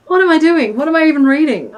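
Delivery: normal talking voice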